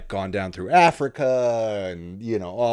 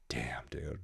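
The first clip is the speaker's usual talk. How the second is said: hushed whisper, much softer; awed whisper; 8 semitones lower, 13 dB quieter than usual. Retold or imitated. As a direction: breathe it out in quiet awe. Awed reaction to crying story.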